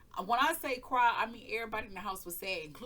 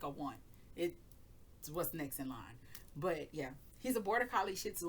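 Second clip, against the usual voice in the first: usual speaking voice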